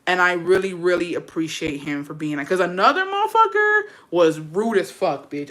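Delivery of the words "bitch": normal talking voice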